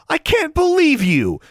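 high, incredulous outburst; outraged exclamation. A narrator's reading. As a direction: exclaim it in disbelief, voice way up. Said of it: "she exclaimed".

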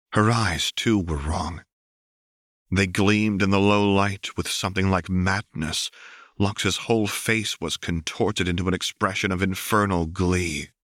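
ordinary delivery

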